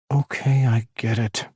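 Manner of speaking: ordinary delivery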